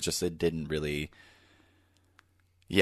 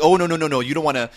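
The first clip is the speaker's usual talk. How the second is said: much higher, louder fussing waiter voice; fussy waiter; 9 semitones higher, 8 dB louder, faster than usual. Retold or imitated. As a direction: fuss over someone like a doting waiter. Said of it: "It's like".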